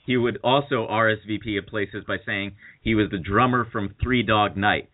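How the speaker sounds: normal talking voice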